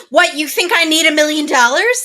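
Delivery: loud, high indignant comeback; mock outrage. Wife twisting a million-dollar gift, mock outraged. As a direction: imitate someone taking huge offense. Imitates wife taking offense at husband.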